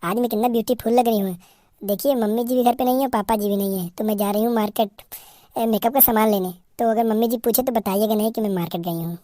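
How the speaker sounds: soft, quick daughter-in-law voice; a lightly voiced bride whispering her secret plan; sneaky young bride. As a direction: slip out quietly before anyone notices.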